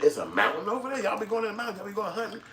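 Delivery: very high-pitched incredulous questions; acted astonishment. Visitor to a small town, mock amazement. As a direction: pitch it way up in hammed-up disbelief.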